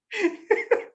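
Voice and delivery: a sudden squeaky laugh; short high laugh; high burst of laughter. Real, not acted. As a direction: break out in a high giggle. Cracks up at own story.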